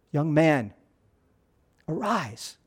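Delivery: soft, hushed words to the dead son; quiet command. Retold or imitated. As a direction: call him back gently, dropping away on arise. Preacher voices Jesus to dead son.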